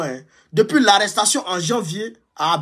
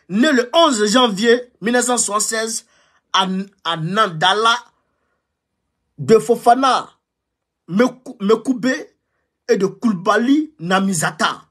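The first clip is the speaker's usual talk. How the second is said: higher formal report read; official report reading; 5 semitones higher than usual. Shown as a demonstration. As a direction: read the intelligence report aloud. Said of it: "je vais vous donner lecture du rapport".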